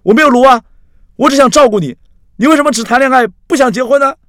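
very high, shouted protest; worked-up protest. A narrator's reading. Axi escalates as Carrie refuses marriage, shouting very high. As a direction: shout it out in frustration. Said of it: "这时候阿西的事情变大了".

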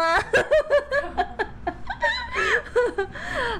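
high laughing fit; long cackling laugh. Real, not acted. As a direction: burst into helpless laughter. Long laugh after demo.

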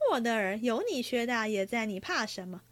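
plain reading voice

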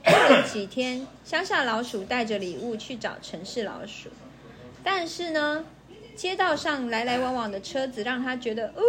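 ordinary delivery, nothing marked

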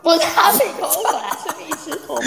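amused laughing correction. Real, not acted. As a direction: set them straight while laughing. Laughing correction of misheard brand.

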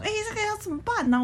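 high, fast mocking whine; mock fussy coworker. Mimicking a coworker panicking over trivial work. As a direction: play the colleague who panics over nothing. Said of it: "然后就大声嚷说".

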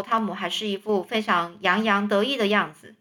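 usual speaking voice

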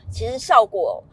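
ordinary delivery

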